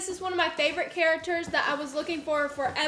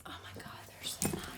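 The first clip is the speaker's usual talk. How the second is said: whisper; 16 dB quieter than usual. Real, not acted. Whispered reaction.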